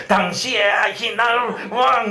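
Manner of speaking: the wrong way, read in a fake temper; angry reading. Shown as a demonstration. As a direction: hammer the verse out crossly, slowly. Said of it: "화내면서 막 이렇게 읽으면 안 돼".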